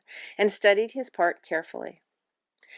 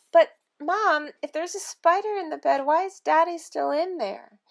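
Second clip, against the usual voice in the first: put-on higher, questioning little-girl voice; puzzled child; 8 semitones higher, 4 dB louder than usual. Anne asks why Daddy stayed in bed, puzzled child. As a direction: reason with Mom in a higher voice.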